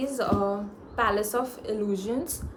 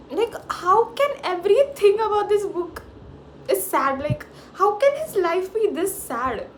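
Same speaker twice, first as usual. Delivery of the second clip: pained; 7 semitones higher, 3 dB louder than usual. Real, not acted. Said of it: "My heart is paining".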